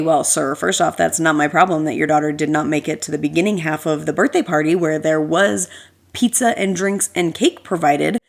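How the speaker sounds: ordinary delivery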